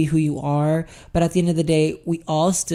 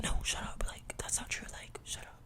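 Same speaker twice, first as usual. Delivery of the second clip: whispered hushing; whispered self-denial; inner voice whisper; 20 dB quieter than usual. Retold or imitated. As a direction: hiss it at yourself to silence the thought. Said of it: "I'm like".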